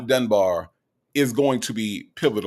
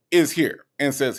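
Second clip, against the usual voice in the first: ordinary talk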